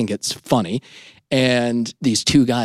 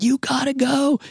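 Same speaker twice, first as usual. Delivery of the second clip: much higher eager call; urging passengers. Retold or imitated. Passengers cheering him to jump, high eager call. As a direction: push him to go.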